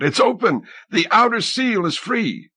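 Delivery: high exultant announcement; exulting. A narrator's reading. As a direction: call it out in triumph. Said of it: "Helene exulted".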